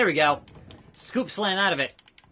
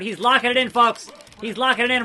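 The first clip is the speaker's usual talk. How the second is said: play-by-play call; 4 semitones higher, 4 dB louder, faster than usual. Acted. Commentator-style call.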